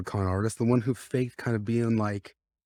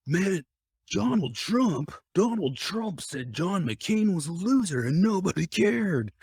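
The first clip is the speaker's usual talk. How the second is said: high, slow mocking voice; drawn-out sneering quote; mock journalist voice; 9 semitones higher, slower than usual. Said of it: "the people who are trying to expose her will say".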